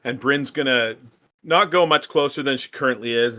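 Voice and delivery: ordinary talk, nothing marked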